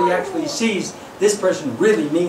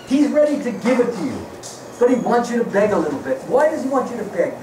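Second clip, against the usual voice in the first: ordinary talk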